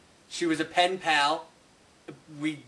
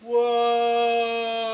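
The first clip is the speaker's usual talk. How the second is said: stretched, rising-volume amazement; amazed whoa; 5 semitones higher than usual. Acted. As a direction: build to a big astonished cry. Astonished cry at a new arrival.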